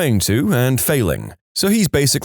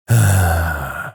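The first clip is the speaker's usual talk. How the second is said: long, drawn-out exasperated sigh; acted sigh; 6 semitones lower than usual. Worker getting no response from patient, acted sigh. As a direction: let out a heavy sigh at the silence.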